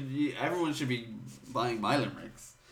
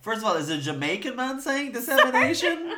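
laughing; 12 semitones higher, 5 dB louder than usual. Real, not acted. Laughing mock Jamaican question.

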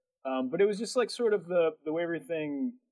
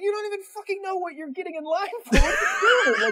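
high, loud incredulous yell through laughter; mock outrage at a clueless stranger; exasperated laughing rant; 7 dB louder than usual. Mocking a man who joined a line blindly, incredulous laughing yell.

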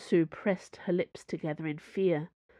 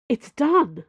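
higher, louder breathless call; gasped; 7 semitones higher, 7 dB louder than usual. A narrator's reading. Cho bursts in on the cardinal, gasping out news. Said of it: "she gasped".

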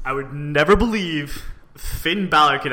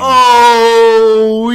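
excited oh; 8 semitones higher, 12 dB louder than usual. Real, not acted. Excited recognition of match.

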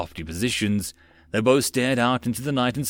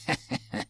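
soft little giggle; 9 dB quieter than usual. A narrator's reading. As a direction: giggle quietly to yourself. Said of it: "Titania giggled".

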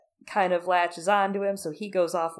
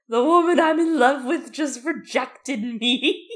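lovesick Tormund wailing, louder and higher; mopey Tormund; 8 semitones higher, 8 dB louder than usual. Retold or imitated. Tormund moaning to Sandor about being rejected, mock lovesick wail. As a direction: whine it like a heartbroken drunk. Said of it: "and he's like".

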